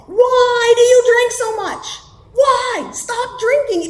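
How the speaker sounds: husband's frantic plea. Retold or imitated. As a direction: yell it like the desperate husband begging. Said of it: "frothy emotional appeals, spittle flying".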